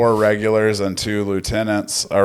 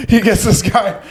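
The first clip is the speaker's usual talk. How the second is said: words breaking up with laughter, voice pitched higher; laughing; 13 semitones higher than usual. Real, not acted. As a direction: laugh right through the words. Retelling a funny historical scene.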